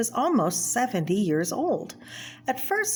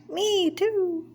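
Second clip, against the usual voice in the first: much higher put-on voice; second cartoon character; 13 semitones higher than usual. Second cartoon character agreeing, in a much higher squeaky voice. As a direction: chirp agreement like a little cartoon figure. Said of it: "the cartoons in this are".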